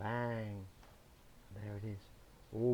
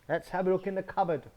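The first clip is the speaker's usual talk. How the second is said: eager wolf; 7 semitones higher, 5 dB louder, faster than usual. Acted. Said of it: "and he says".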